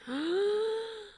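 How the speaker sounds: high, drawn-out theatrical gasp; shocked gasp. Acted. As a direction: gasp in mock horror. Narrator discovers she is naked. (entsetztes Aufkeuchen)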